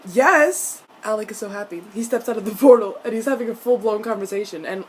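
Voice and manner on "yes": loud, high, emphatic yes; excited fan cheer. Real, not acted. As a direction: cheer it out, thrilled.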